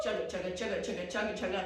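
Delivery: low, rhythmic chugga; chugging train noise. Pretend. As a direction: chant it like turning train wheels.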